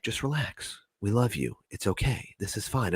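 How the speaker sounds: calm a frightened friend gently; soothing. Retold or imitated. Duck and robot beings soothing the freaked-out speaker softly.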